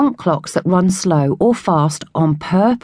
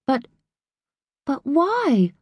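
high, stammered, softer protest; spluttering; 8 semitones higher, 4 dB quieter, slower than usual. Acted. Lily stunned that Finn won't come, a high spluttering why. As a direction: sputter out the question in shock. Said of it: "Lily spluttered".

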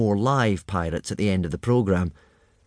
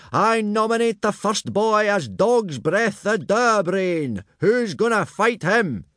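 much higher, slow announcing call; booming instructor; 11 semitones higher, slower than usual. A narrator's reading. Gobber calls for a challenger, much higher and slow.

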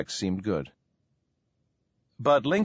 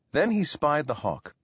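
plain reading voice

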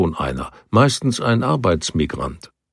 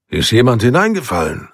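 raised, higher call over the water; a calling voice, pitched up; 5 semitones higher than usual. A narrator's reading. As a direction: call the question down to the bank. Calling down to the men.

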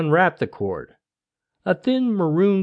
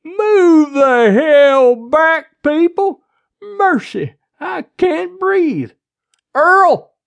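high, loud, drawn-out bellow; slow, high-pitched shout; yelling; 14 semitones higher, 9 dB louder, much slower than usual. A narrator's reading. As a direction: holler at the crowd at full voice. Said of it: "before yelling".

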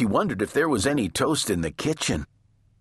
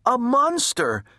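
high, alarmed cry; frightened shout; 4 dB louder than usual. A narrator's reading. Baby seeing a snout at her window, shouting. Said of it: "shouted Baby".

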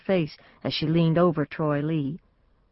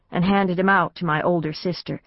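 plain reading voice

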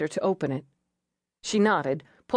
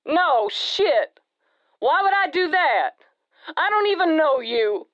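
much higher, louder, rattled man; agitated jumper; 10 semitones higher, 4 dB louder than usual. Acted. Jumper answers the negotiator, agitated and higher. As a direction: blurt it out, flustered and upset.

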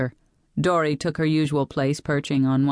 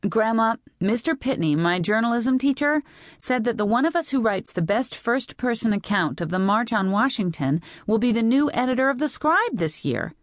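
put-on higher, earnest girl's voice; teenage Lee, pleading; 7 semitones higher than usual. A narrator's reading. Teenage girl persuading her grandmother about the article, high and insistent. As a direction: plead the case eagerly to Grandma.

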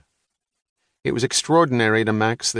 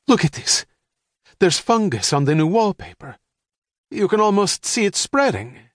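much higher, rising alarm; wife alarmed at fungus on the wall; alarmed mother; 10 semitones higher, 3 dB louder than usual. A narrator's reading. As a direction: point it out in growing horror.

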